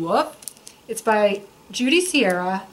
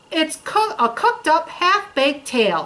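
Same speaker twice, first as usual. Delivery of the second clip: put-on snapping gingerbread boy; 5 semitones higher than usual. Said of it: "snapped the gingerbread boy".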